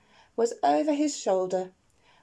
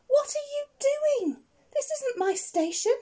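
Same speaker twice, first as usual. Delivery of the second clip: a much higher, outraged cry; indignant shout. Acted. Train driver shouts at Digger, indignant and much higher. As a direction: shout it out, scandalised and shrill-high. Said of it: "shouted the driver with great indignation".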